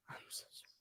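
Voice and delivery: real whisper, barely audible; a hushed apology to the stream while his wife sleeps. Real, not acted. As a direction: whisper sorry so nobody wakes.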